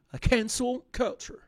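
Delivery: slow English-accented "cancel culture". Shown as a demonstration. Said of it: "Avec mon accent explosé, je vais essayer de le refaire".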